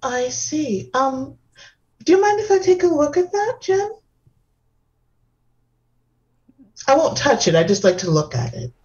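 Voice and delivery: high, airy, dainty hostess; delicate femme voice. Acted. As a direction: gush sweetly like a dainty socialite. Same dainty character.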